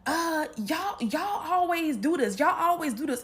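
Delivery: whiny complaint. Retold or imitated. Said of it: "So my sister's like".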